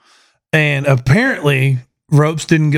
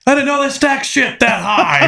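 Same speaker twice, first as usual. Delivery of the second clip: 1 drill sergeant impression; 10 semitones higher than usual. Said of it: "like he's a drill sergeant from Full Metal Jacket".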